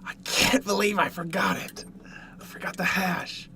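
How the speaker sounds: breathy whisper to himself; whispered regret. Real, not acted. Streamer scolds himself for forgetting the sauce, in a whisper. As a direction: whisper it under your breath, kicking yourself. Muttered self-reproach about sauce.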